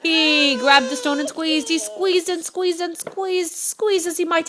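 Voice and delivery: slow, high, drawn-out squeezing; straining effort. A narrator's reading. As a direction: stretch each squeeze as if straining. Narrator acts out straining effort.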